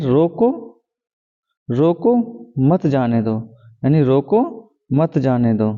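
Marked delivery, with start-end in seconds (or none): 1.7-3.4 रोको rising, pause, then मत जाने दो falling; pause after रोको. Shown as a demonstration. Teacher reading an example line to students, pause after रोको. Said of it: "comma है". 4.0-5.7 रोको lifted, a clear break, मत जाने दो dropping; pause after रोको. Shown as a demonstration. Teacher repeating the example for students, pause after रोको. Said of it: "comma है".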